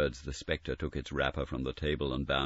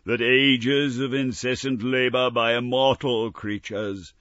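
lamenting ghost; 5 semitones higher, 4 dB louder than usual. Acted. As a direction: mourn a wasted life as a spirit. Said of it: "cried the phantom".

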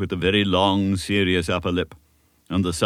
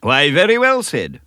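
hearty, higher and louder approval; hearty praise; 8 semitones higher, 8 dB louder than usual. A narrator's reading. Minister praising Davy's promise, hearty and loud. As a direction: beam with approval. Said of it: "replied Mister Campbell heartily".

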